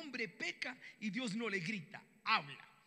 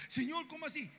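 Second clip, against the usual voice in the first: high, loud incredulous question; shocked protest; 7 semitones higher, 5 dB louder than usual. Acted. Hosea answering God's order to love a prostitute, incredulous. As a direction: balk at it in acted astonishment.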